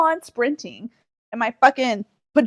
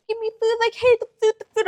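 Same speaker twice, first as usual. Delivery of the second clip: cat voice; 9 semitones higher, faster than usual. Retold or imitated. Voicing the meowing cat.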